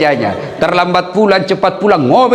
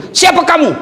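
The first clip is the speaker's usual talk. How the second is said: much higher alarmed cry; startled husband confronting a towering jinn; startled shout; 12 semitones higher than usual. Retold or imitated. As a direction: jump in fright at the creature.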